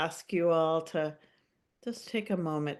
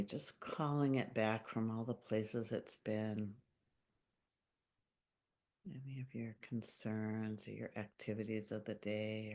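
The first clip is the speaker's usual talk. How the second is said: calm meditation voice with long pauses; unhurried, gently guiding listeners into their bodies; guided meditation; 4 semitones lower, 4 dB quieter than usual. Acted. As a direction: lead a grounding visualization, phrase by phrase, leaving long silences. Leads a grounding meditation.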